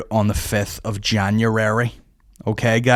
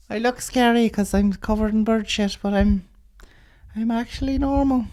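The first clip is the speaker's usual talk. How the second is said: pigeon lady voice; 13 semitones higher than usual. Acted. Comic telling the audience, as a high batty pigeon lady. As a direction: pitch it way up and drag it out like a batty bird woman.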